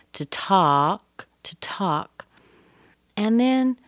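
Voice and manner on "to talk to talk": short "to", longer "talk"; stress on TALK. Shown as a demonstration. Teacher shows a little stress, on TALK. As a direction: let "to" pass lightly and land on "talk". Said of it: "little bit of stress there".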